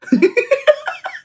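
high, bright laugh at herself; burst of laughter. Real, not acted. To listeners after admitting she can't dance, a high laugh. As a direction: laugh off her own clumsiness. Admits being bad at dancing.

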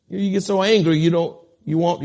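ordinary talk

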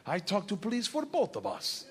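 a hint of nonchalant Russian mobster; Big Igor, unfazed. Igor reassures his accomplice about police, softly unfazed Russian. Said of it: "Big Igor sees this and is completely unfazed".

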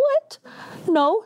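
very high shocked squeak of disbelief. Retold or imitated. To her aunt who says she's pregnant, a high shocked what.

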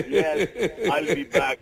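lightly mock-Austrian action hero catchphrase; laughing, half-hearted movie tough-guy impression; Arnold Schwarzenegger. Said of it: "he sounded like Arnold Schwarzenegger".

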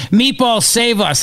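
half-joking call for rescue; mock crowd plea. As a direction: crowd pleading for Meatball, lightly imitated. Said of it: "people going".

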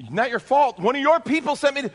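high, loud indignant outburst; indignant protest. Retold or imitated. Traveler protesting to the airline manager, high loud indignation. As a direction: push back hard, voice shooting up.

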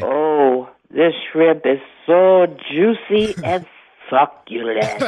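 low, slow seductive demo; hammed-up sultry drawl; deep sexy voice. Shown as a demonstration. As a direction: savor each word, deep and drawn out. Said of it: "Talk lower and slower than you usually do".

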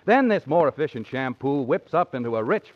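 ordinary talk

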